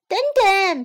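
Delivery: high-pitched, loud chick shout. A narrator's reading. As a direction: cry out as a little chick. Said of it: "皮普喊道".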